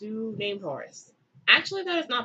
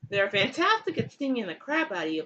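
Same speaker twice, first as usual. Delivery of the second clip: normal talking voice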